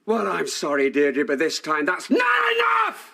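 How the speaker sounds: bitter anger exploding. Acted. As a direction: hold it in, then explode on the last words. Betrayed husband rejects her apology.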